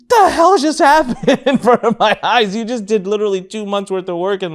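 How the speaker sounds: high, loud incredulous outburst breaking into laughter; hammed-up stunned reaction, pitched high; retold astonishment. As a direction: exclaim in laughing disbelief. Acts out own stunned reaction.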